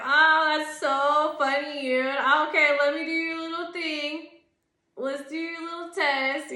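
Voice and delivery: loud, high, fake delighted voice; sarcastic mock cheer. Mocking the people who made her take their test, fake cheer. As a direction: gush with mock enthusiasm, dripping sarcasm.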